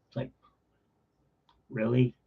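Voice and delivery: usual speaking voice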